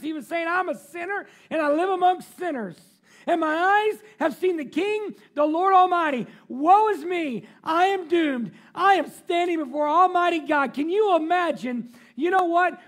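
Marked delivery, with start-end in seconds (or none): 0.5-6.3 anguished lament. Acted. Isaiah's lament paraphrased. 6.5-7.5 woe is me cried out loud; loud anguished cry. Acted. Isaiah before God, crying out in loud dread. 7.6-10.7 loud anguished cry. Acted. Isaiah's cry continues.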